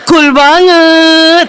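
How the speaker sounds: very drawn-out admiring slang; gushing youngster. Retold or imitated. Young people admiring a stern-faced man, drawn-out mock gush. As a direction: stretch the words in mock swooning. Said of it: "Anak sekarang bilang".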